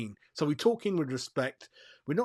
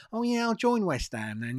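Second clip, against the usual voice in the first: higher, put-on lazy player voice; mocking the player; 8 semitones higher than usual. Mocking Todibo's late yes to West Ham, higher put-on voice. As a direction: mimic the late, casual change of heart. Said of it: "two days before the window".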